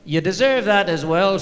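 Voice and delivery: put-on wife's cheeky retort in a loud Irish accent. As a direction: fire back the jibe in a mock Irish accent. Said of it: "said in this Irish accent".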